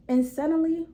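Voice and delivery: ordinary delivery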